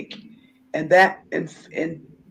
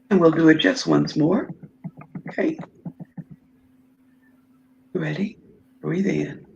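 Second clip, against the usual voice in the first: guided breathing. Acted. Leads breathing exercise.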